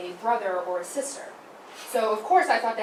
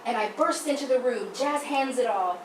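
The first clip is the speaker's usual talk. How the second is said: ordinary talk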